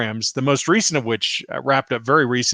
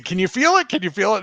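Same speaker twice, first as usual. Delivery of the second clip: eager asking; 7 semitones higher, faster than usual. Retold or imitated. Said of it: "I kept asking".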